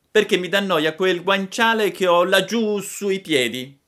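high, loud complaining puppet; Pinocchio lamentoso. A narrator's reading. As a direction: make excuses in a whiny child voice. (burattino lagnoso, voce acuta)

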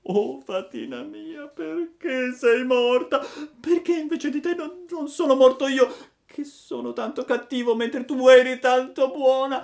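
put-on high, loud puppet-child voice in tears; piercing, heartbroken crying plea; tearful wailing lament. A narrator's reading. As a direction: wail in despair, stammering through sobs. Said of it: "E piangendo diceva".

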